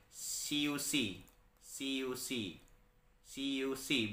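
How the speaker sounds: "siusi" said with a clean s in both halves; X read as S. Shown as a demonstration. Teacher giving the Mandarin reading, s sounds. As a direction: model "siusi" carefully, s not x. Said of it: "dalam bahasa Mandarinnya itu menjadi".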